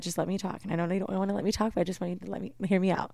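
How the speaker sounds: very soft, subdued appeal; hushed plea, retold. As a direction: gently ask to be heard, re-enacted. Retold conciliatory phone plea.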